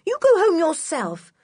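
high-pitched, defiant comeback; indignant retort. A narrator's reading. George standing up to Block for the dog, indignant. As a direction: throw it back at him, high and cross.

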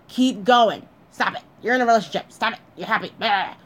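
put-on nagging little devil; devil on the shoulder. Devil urging the woman on, mock-nagging. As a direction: egg her on in short bursts. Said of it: "And the devil's like".

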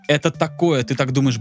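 ordinary talk

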